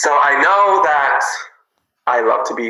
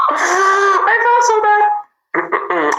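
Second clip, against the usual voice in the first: very high, drawn-out wail; gasp, then wail it high and slowly; on the verge of crying; tearful squeal; much slower than usual. Real, not acted. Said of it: "I'm gonna cry".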